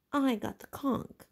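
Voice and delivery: wounded, insisting on his turn; a boy stung at being ignored; hurt Piggy pleading to speak. A narrator's reading. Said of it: "said Piggy in a hurt voice".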